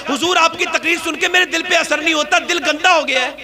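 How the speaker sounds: high, ringing sermon delivery; impassioned preaching. A narrator's reading. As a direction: exhort the crowd at full force. Impassioned preaching at full force.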